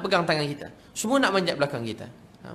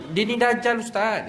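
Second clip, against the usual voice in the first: high, put-on old grandmother; grandmother's voice; 8 semitones higher, 3 dB louder, slower than usual. Grandmother calls her grandson the antichrist, in a high put-on voice. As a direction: play the grandmother, pitched up. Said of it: "dia kata".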